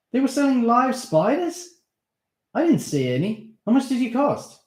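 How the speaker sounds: excited. Acted. Steve excited about spiders.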